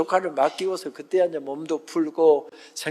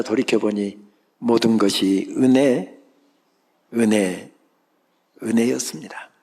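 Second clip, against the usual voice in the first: reverent, slow; 6 semitones lower than usual. Real, not acted. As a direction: lower and slower, savoring each 은혜. Sermon climax on grace.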